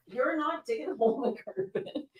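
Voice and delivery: soft high voice for a pet digging the carpet; soft pet talk. Real, not acted. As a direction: coo a scold down at the pet. Scolding a pet at her feet.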